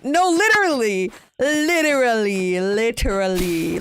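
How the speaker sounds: emphatic agreement. Real, not acted. Streamer ranting about who can be romanced, emphatic agreement.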